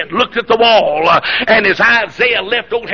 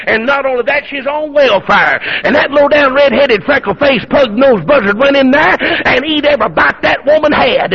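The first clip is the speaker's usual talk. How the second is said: raised, punchy string of mock insults; indignant preaching; 4 semitones higher, 4 dB louder than usual. Preacher imagining town gossip about Elijah, indignant preaching. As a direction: pile up the insults in pulpit outrage.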